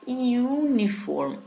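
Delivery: high, slow uniform starting on a clear yu; uniform said slowly, yu first; slow yu sound. Shown as a demonstration. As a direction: draw out the yu in uniform. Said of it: "I U, tem som de I U".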